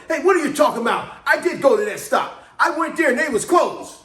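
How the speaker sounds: put-on loud, high, indignant old driver; an offended old driver yelling over the radio; grumpy old man snapping. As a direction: bark back like an offended old-timer. Grumpy old driver snaps back.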